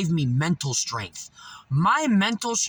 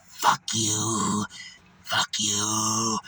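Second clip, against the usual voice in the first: low mock curse; 6 semitones lower, much slower than usual. Beggar cursing the narrator in town, low repeated curse. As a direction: lower imitation of her muttered curse. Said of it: "and she went".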